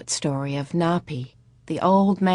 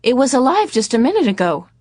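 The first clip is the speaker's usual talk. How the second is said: high, louder lilting tune; dazed mother insisting, high and sing-song; 7 semitones higher, 6 dB louder than usual. A narrator's reading. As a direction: sing it out brightly, strangely cheerful. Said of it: "in that same sing-song voice".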